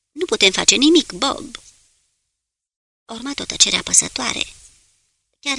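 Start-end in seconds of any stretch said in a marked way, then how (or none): none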